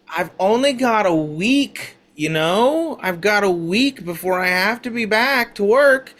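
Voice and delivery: whiny blonde lady. Acted. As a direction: whine about the weather like a bored tourist.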